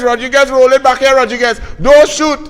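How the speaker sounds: loud, high call on the pitch; shouted warning. Retold or imitated. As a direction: yell it across the field to a teammate. Shouting to teammate mid-play.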